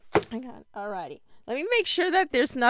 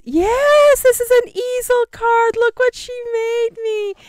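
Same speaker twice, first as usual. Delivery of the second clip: excited; 8 semitones higher, 7 dB louder than usual. Real, not acted. Showing off the easel card.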